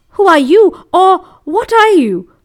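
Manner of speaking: put-on high, loud young boy's demand; startled questioning. A narrator's reading. Crow questions the voice from the rock, startled. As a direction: pitch it up, loud and alarmed.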